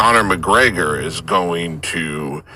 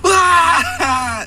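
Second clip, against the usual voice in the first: put-on very high loud baby wail; baby cry; 4 dB louder than usual. Shown as a demonstration. Showing adults can't cry like babies, high wail. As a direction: cry "Wah" with everything. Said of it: "just be like".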